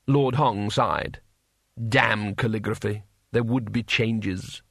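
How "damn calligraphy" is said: ordinary delivery, nothing marked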